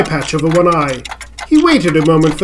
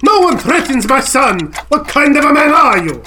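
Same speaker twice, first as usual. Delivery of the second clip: put-on higher-pitched outrage in an Italian accent; indignant Italian father; 7 semitones higher than usual. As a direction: cut him off, defending your child. Father defends his threatened son.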